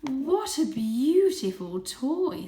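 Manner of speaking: high, slow, loud gushing; mother cat admiring the gift, high and slow. Acted. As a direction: gush over it sweetly.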